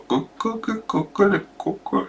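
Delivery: put-on high, quiet worried baby goo-goos; mock baby voice, hushed and high; concerned baby babble. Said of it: "a little bit concerned".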